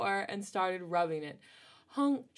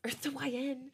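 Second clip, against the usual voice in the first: a touch higher, breathy call; soft breathy boy; 5 semitones higher, 4 dB quieter than usual. A narrator's reading. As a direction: murmur it to snap her back. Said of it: "he whispered".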